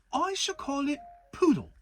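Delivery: put-on a boy pleased with himself; Christopher Robin voice. A narrator's reading. Christopher Robin naming Piglet, lightly voiced boy.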